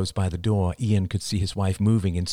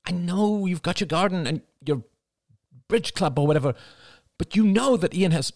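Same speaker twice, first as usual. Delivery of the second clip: escalating exasperation; 10 semitones higher than usual. A narrator's reading. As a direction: argue with your mother on the phone. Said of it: "Cassie's voice escalated".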